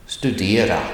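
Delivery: "Studera" with the tone going up on "de"; rising tone accent. Shown as a demonstration. Teacher repeats the accent one example, rising tone on "Studera". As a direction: lift the pitch on the middle of "Studera". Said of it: "It goes up".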